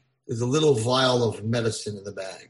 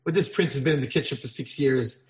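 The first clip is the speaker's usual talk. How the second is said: ordinary delivery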